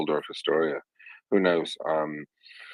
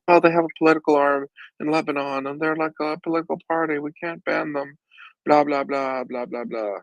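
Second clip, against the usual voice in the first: high, mocking voice of a babbling diplomat; mocking European politicians; 10 semitones higher, 4 dB louder than usual. Host mocks European excuses for Hezbollah in a high, sneering voice. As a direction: sneer it out like a prissy bureaucrat. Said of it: "babbling European countries like to say stupid things like".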